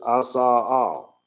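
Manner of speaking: slow, careful "I saw all"; Hebrew pronounced slowly. Shown as a demonstration. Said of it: "I'll just tell you what it is".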